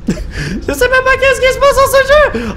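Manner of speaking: high, slow laughing squeal. Real, not acted. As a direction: laugh it out in disbelief. Laughs after dying. (en riant aux éclats)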